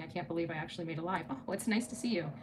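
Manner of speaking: usual speaking voice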